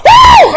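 high loud woo; whoop. Shown as a demonstration. Preacher showing the congregation how he shouts, high woo. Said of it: "I got something to go".

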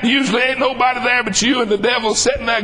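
normal talking voice

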